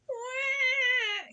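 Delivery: long, high imitated baby cry; storybook baby's "wah"; baby ghost wail. A narrator's reading. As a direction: wail like a hungry infant on one held note. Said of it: "yelled the baby ghost".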